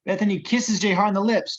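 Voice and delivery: ordinary talk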